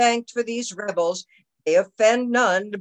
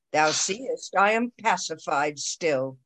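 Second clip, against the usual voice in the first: usual speaking voice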